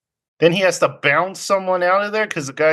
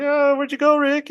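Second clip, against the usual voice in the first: put-on much higher, pleading girlish voice; jilted girl; 13 semitones higher than usual. Girl from last night asking Rick where he went, high and pleading. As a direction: whine up at him sweetly. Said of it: "and she's like".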